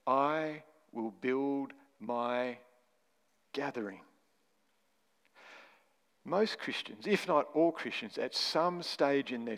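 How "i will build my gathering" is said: I will build my gathering, very slow, fading on gathering; slow deliberate quote. Shown as a demonstration. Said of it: "Jesus is saying".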